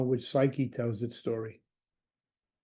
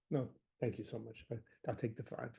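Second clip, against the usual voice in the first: soft modest; 4 semitones lower, 15 dB quieter than usual. Retold or imitated. Patient voicing boyfriend refusing more.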